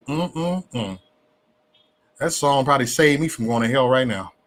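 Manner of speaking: usual speaking voice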